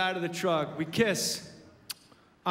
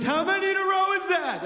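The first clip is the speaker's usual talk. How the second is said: put-on much higher, loud boyish amazement; stunned kid; 10 semitones higher, 4 dB louder than usual. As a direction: ask it wide-eyed as a little kid. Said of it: "And he goes".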